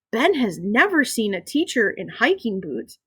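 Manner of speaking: plain reading voice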